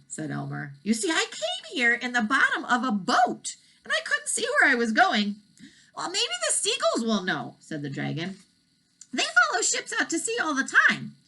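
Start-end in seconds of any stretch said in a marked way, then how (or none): 0.8-5.3 put-on raised young voice; lightly boyish explaining. Elmer explains his boat trip, lightly boyish. Boy voice. 5.9-11.1 high dragon voice offering a trip; eager, raised dragon voice; kind dragon. Acted. Dragon offers Elmer a trip, high and eager.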